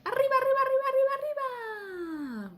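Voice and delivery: high, loud cartoon mouse yell; Speedy Gonzalez shout. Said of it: "he shouts".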